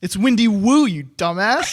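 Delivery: loud teasing punchline. Acted. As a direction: shout the joke's payoff. Joke punchline, mock insult.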